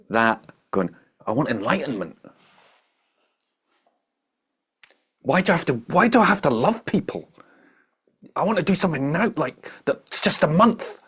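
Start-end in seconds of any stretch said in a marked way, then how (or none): 1.3-11.0 much higher, self-pitying mock whine; whiny grasping mind. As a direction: voice a spoiled ego demanding enlightenment now. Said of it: "whiny, self-pitying, grasping mind".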